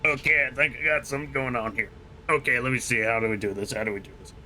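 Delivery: strained Italian American New Yorker; New York smoker accent. Shown as a demonstration. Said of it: "make it sound like they're smoking".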